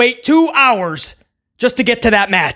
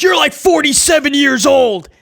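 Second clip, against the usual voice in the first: quoting a shout; 6 semitones higher than usual. Retold or imitated. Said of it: "and he says".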